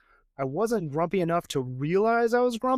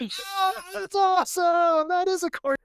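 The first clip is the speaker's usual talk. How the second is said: delighted amazement; 13 semitones higher than usual. Retold or imitated. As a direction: imitate a fan gushing in a high voice. Retells customers' delight at reveal.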